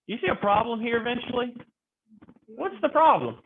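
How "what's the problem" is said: usual speaking voice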